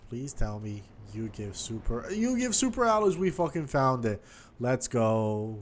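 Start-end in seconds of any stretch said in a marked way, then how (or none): 2.1-5.6 high, loud burst of joy at the find; real triumphant cheer; excited shout. Real, not acted. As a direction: yell it out, thrilled you finally found it. Finds the cave he searched for.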